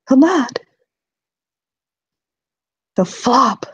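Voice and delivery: ordinary delivery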